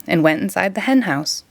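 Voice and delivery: plain reading voice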